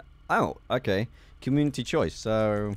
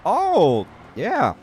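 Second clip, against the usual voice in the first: loud, high "oh yeah"; triumphant cheer; 8 semitones higher, 9 dB louder than usual. Real, not acted. Gamer landing the stunt, loud triumphant cheer.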